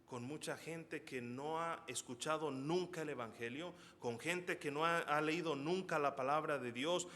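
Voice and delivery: ordinary talk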